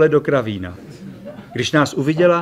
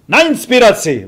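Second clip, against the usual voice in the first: loud, high festive toast; toast called out; 7 semitones higher, 5 dB louder than usual. A narrator's reading. Ferry calling a toast to his companions. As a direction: raise the bottle and call it out.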